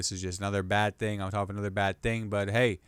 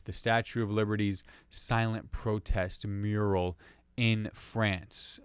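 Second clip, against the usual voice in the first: ordinary delivery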